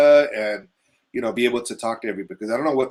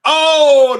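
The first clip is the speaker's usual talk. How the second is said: startled oh; 12 semitones higher, 12 dB louder than usual. Real, not acted. Reacting to coronavirus remark.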